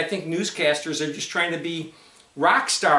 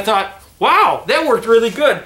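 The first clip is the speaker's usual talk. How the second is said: muffled behind face covering; speaking through wrapped cloth; through a cloth mask; 4 semitones higher, 8 dB louder than usual. Real, not acted.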